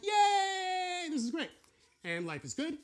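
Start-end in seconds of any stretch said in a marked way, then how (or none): 0.0-1.5 high, loud, happy squeal; mock cheer. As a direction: cheer like a relieved believer. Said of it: "And then we're like".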